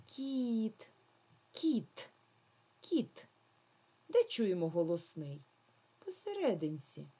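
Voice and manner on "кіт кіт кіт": each кіт said slowly, stretched, sound by sound; sounding out a word for a class; drawn-out sound analysis. Shown as a demonstration. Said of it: "скласти звукову схему до слова".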